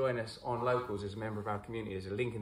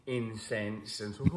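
ordinary talk

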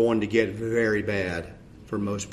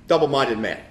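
usual speaking voice

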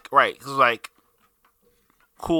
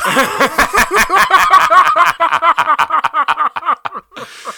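loud, high burst of laughter; 11 dB louder than usual. Real, not acted. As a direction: howl with laughter. Laughing at edit claim.

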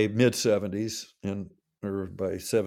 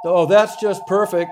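mock sarcastic; 8 semitones higher, 9 dB louder than usual. Quoting Don's ironic reply.

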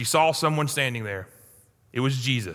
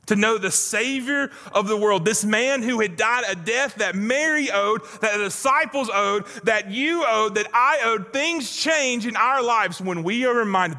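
preaching build; 5 semitones higher than usual. Acted. Pastor stirring the congregation, rising preaching. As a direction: build the appeal line by line.

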